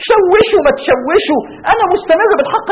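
high, loud, drawn-out defiance; defiant resolve. Acted. Preacher voicing the Prophet's answer to disrupters, defiant. Acting the Prophet defying hecklers.

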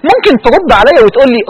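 usual speaking voice

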